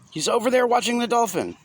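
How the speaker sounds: put-on high young boy voice, urgent; anxious child. A narrator's reading. Boy tells Dad where his brother is, high and urgent. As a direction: answer like a worked-up little kid.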